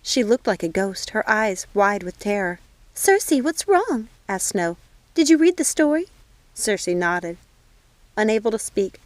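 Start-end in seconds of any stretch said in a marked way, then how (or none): 2.9-4.1 higher, slow and anxious; worried alarm. Acted. Circe in shock. 5.1-6.1 reach out in fear for her cousin; worried alarm. Acted. Circe in shock.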